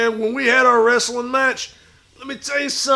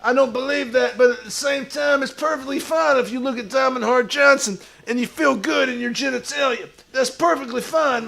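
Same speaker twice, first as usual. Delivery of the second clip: ordinary talk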